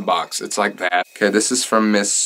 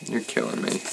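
breathless, near-tears whisper; real choked whisper from a touching letter; 11 dB quieter than usual. Real, not acted. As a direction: barely get it out, moved to tears. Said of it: "You're gonna make me cry".

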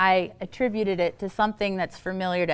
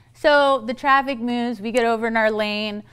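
normal talking voice

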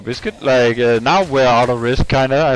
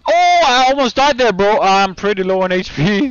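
high, loud near-miss panic; hyped gamer bro; 9 semitones higher, 6 dB louder than usual. Real, not acted. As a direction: shout in relief and alarm. Almost died in game.

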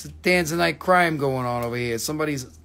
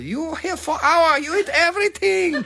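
put-on very high-pitched accented scolding; comedy-routine impression; 12 semitones higher than usual. Restaurant host throwing out a big eater, very high mock scolding. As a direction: shriek it as a comic accented scold. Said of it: "This is from a nineteen ninety something".

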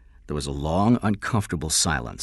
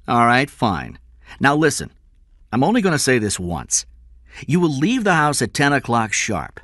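raised, loud, insistent instructions; commanding; 7 semitones higher, 5 dB louder than usual. Acted. Kidnapper dictating ransom terms by phone, commanding. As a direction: lay down orders firmly.

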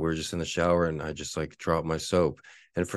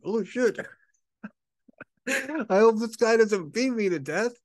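mock panic; 4 dB louder than usual. Fleeing in panic.

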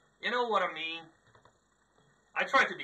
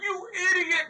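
mock teammates yelling; 13 semitones higher, 10 dB louder than usual. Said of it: "my team's like".

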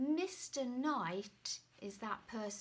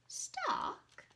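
high, light neighbour. Acted. Neighbour overhearing, high questioning voice. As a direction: squeak it out as a curious neighbour.

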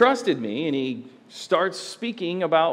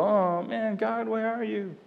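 higher, softening mopey groan; mock moping; 6 semitones higher, 3 dB quieter, slower than usual. Imagining Isaac asking where God is, mock moping. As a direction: mope it out as a hammed-up lament. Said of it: "does he mope and go".

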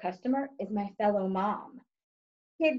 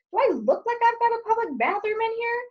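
mock indignant shriek; 13 semitones higher than usual. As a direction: shriek it in shocked disbelief. Outraged at bathroom request.

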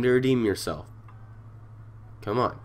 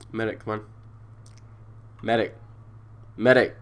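usual speaking voice

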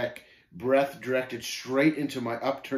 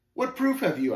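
high, sharply raised challenge; demanding; 11 semitones higher, 4 dB louder than usual. A narrator's reading. Pilgrim challenging Shame for proof, demanding. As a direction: insist on an answer. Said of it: "I demanded".

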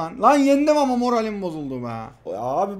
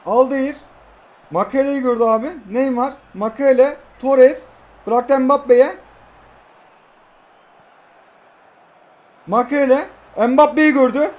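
loud, high shouts of each name; excited calling; 4 semitones higher, 5 dB louder than usual. Real, not acted. Gamer narrating his passing move, loud and high. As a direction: bark the names as the ball moves. Calling passes in rising attack.

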